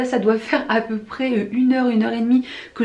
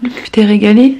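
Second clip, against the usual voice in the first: loud teasing; 8 dB louder than usual. Real, not acted. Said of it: "loud playful question".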